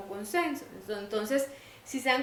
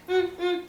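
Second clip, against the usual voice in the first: two high "mm" grunts of demand; high-pitched pointing grunt, like a toddler; imitated child's wordless grunt; 8 semitones higher, 4 dB louder than usual. Imita niño que pide con gruñidos. (quejido agudo imitando a un niño que pide)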